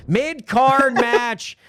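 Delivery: loud, high, stretched-out announcement; match announcer. Pretend. Host announcing the next match, slow booming announcer style. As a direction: draw out the segment title like a ring announcer.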